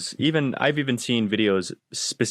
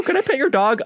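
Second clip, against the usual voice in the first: high-pitched mocking question; oblivious stranger; 12 semitones higher than usual. Stranger ignores the do-not-pet vest, high-pitched mocking imitation. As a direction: mimic someone oblivious to every warning.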